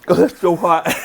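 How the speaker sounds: loud, high laughing gasp; laughing at the heat. Real, not acted. First bite of super-hot sauce.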